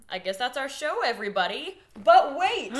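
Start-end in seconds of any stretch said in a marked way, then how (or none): 2.0-2.7 announcer. Acted. Infomercial announcer tease.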